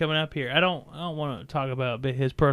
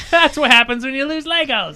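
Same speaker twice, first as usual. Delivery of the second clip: laughing; 9 semitones higher, 8 dB louder than usual. Real, not acted. Laughing at the Legolas joke.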